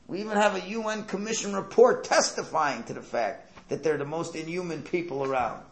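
high, loud sarcastic advocacy; mock indignant defence. Sarcastically defending the bombers to a critic, mock indignation. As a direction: plead their case with hammed-up indignation.

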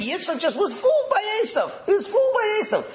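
high-pitched, scoffing paraphrase; incredulous, sing-out mockery of a popular opinion; mock naive view. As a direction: parrot the common view way up high. Mocking a common naive view.

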